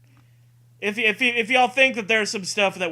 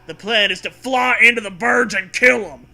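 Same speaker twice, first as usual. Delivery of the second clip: yelling over rotor noise; 5 semitones higher, 7 dB louder than usual. Acted. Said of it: "Kip yells over the roar".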